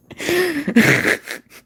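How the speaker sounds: high, loud burst of real laughter; sudden uncontrollable high-pitched laugh; laughing fit. Real, not acted. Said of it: "I don't know why I'm laughing".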